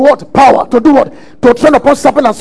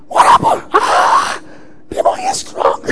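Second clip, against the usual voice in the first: high, breathy mock cry of a defeated minister; hammed-up crying lament; mock whimpering victim; 5 dB quieter than usual. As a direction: whine it out like someone beaten by a demon. Mimicking a deliverance victim.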